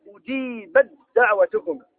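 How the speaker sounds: أجيبت دعوتكما slowly with the ta merged into a doubled dal; slow recitation with assimilation; slow tajweed recitation. Shown as a demonstration. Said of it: "ما تنطق التاء؟".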